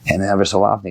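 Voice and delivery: usual speaking voice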